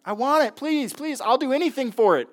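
high-pitched mock child pleading; begging kid. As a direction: imitate kids begging at Christmas. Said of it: "Is that your kids this Christmas time".

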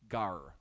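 Gar said as a short "gahr"; Greek word gar. Shown as a demonstration. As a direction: say Gar slowly, teaching the sound. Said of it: "That's how you pronounce it".

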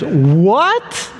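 sweeping rise of disbelief; incredulous. Acted. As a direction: draw it out into a stunned question. Jack balks at God's order.

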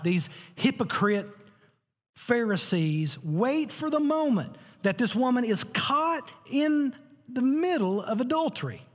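high-pitched, slow, hard-hit storytelling; impassioned preaching. Real, not acted. Preacher telling of the woman caught in adultery, impassioned. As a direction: draw the words out and raise the pitch sharply.

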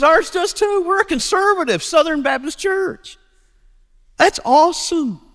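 very high, gushing acted voice; acting overjoyed, pitch way up; thrilled agreement. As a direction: celebrate the match in a high mock-thrilled voice. Stranger thrilled at matching church.

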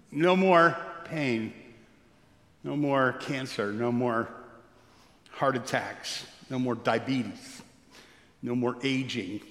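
slow soft litany. Acted.

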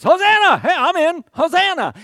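high, loud joyful shout of Hosanna; cheering crowd. Retold or imitated.

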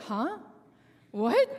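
retold baffled "huh, what?"; a touch of bewilderment; puzzled surprise. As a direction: blurt it as if caught off guard. Retold confused reaction to question.

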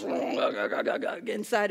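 slow, stuttering rumble into the words; subwoofer imitation. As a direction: mimic bass pulsing inside the body. Imitates subwoofer rumbling inside body.

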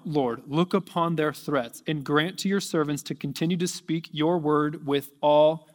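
usual speaking voice

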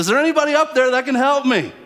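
loud, high, fast desperate yell upward; man on the cliff yelling up for help; yelling again. Retold or imitated. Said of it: "He yells again".

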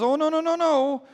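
a father panicking to take it back; horrified. Retold or imitated. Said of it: "the father's horrified".

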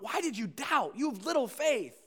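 mock rebuke, pushed up in pitch and volume; stern rebuke. Shown as a demonstration. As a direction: scold as a demonstration of how not to say it. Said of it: "not said as a rebuke".

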